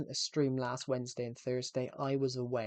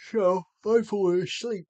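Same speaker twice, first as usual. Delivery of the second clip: silly voice; 6 semitones higher, 9 dB louder than usual. Pretend. Sudden odd voiced outburst.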